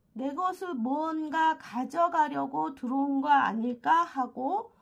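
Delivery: ordinary talk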